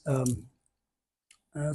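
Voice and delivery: ordinary talk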